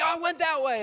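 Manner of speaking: high, loud acted shout; hammed-up urgent call; excited kid yelling. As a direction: holler directions back like an excited child. Kids yelling back directions.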